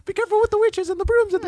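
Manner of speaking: put-on very high squeaky child voice; little girls' voices. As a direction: call her name like excited little girls. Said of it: "talking a hundred miles per hour".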